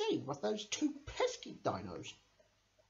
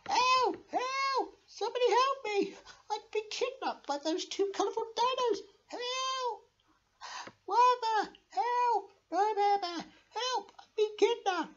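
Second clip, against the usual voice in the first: much higher squeaky calls, desperate; high cries for help. Acted. Kidnapped Bertha calling to Werther, squeaky cries. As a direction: wail for rescue like a tiny captive.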